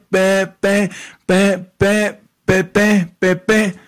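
mock a nasal man who stutters on every word; nasal stutterer. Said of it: "cuate gangoso y tartamudo". (imitación de tartamudo gangoso, voz aguda)

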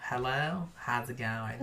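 low, soft mimicry of a friend; Ariel. Imitating a friend to her face, soft and low. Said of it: "That's how I sound to you?".

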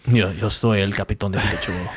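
lightly put-on Spanish accent, a playful cartoon captain; mock Spanish captain. Podcast cohost voicing Captain Pikachu in a lightly mocking Spanish accent. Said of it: "He's gonna talk Spanish".

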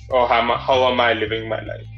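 normal talking voice

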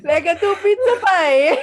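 laughing mock-Italian accent; high, giggly imitation of an Italian accent; Paolo. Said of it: "the way he spoke was very".

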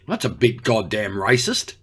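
ordinary delivery

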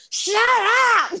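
high, loud mock bellow; retold furious shout. Retelling Justin snapping at the screaming kids, mock furious shout. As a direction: imitate an exasperated dad yelling over screaming kids.

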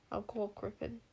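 soft, hesitant queen; uncertain. A narrator's reading. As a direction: say it softly, unsure of yourself. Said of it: "said Queen Sycorax uncertainly".

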